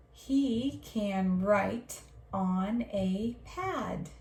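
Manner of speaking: slow, clear reading of each word; slow model reading. Shown as a demonstration. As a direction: read it slowly for the class to copy. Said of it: "Your turn".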